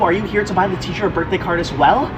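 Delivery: usual speaking voice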